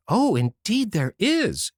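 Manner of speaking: eager cry. A narrator's reading. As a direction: rush to defend the bright side. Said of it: "cried Anne".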